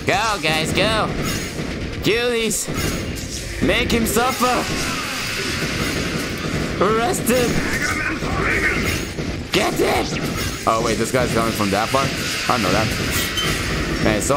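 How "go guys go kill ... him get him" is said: excited commands. Pretend. Commanding units in game.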